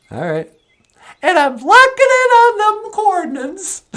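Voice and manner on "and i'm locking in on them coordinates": very high, bright greeting; a cheerful high puppet hello; Natasha's puppet voice. Acted. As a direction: pitch way up for the puppet. Puppet Natasha locks coordinates.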